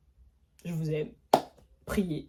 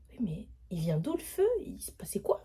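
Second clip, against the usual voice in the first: soft breathless whisper; stunned whisper; whispered shock; 6 dB quieter than usual. Retold or imitated. Stunned quiet questioning.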